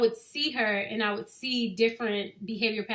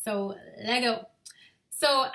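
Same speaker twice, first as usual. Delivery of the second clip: ordinary talk, nothing marked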